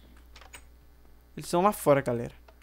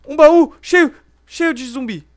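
startled yell; 6 semitones higher, 12 dB louder, slower than usual. Real, not acted. Zombies burst from chest.

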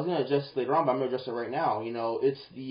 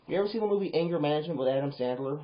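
usual speaking voice

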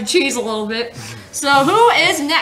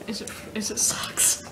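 whispering; 6 semitones lower, 12 dB quieter than usual. Real, not acted. Aside whisper during tasting.

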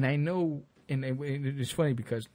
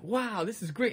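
delighted; 8 semitones higher, slower than usual. Retold or imitated. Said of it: "And I'm like".

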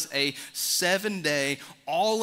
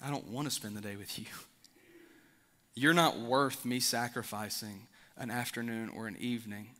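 low and much softer, cold and dismissive; contemptuous refusal; 4 semitones lower, 4 dB quieter than usual. Acted. An invitee coldly tells the host no, in a low, soft voice. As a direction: turn the invitation down like the host isn't worth it. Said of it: "they say".

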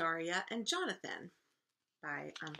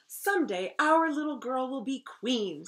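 loud, raised storybook voice; proclaiming king; 5 semitones higher, 10 dB louder than usual. A narrator's reading. King speaking of his baby's future, loud proclaiming. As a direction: announce it high and loud.

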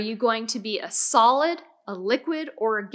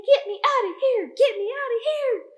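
much higher, urgent repeated plea; trapped gas pleading; 11 semitones higher, 3 dB louder than usual. Pretend. Teacher voicing the gas inside the container, high plea. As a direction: squeak like the gas wants out. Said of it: "It's saying".

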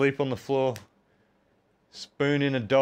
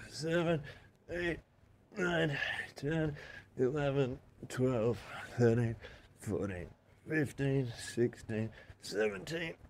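strained, soft counting between reps; counting through push-ups; 6 dB quieter than usual. Real, not acted. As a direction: count each rep under effort, quieter. Counting push-ups mid-workout.

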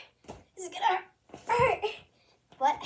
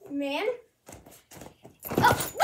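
ordinary delivery

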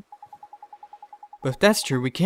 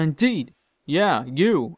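usual speaking voice

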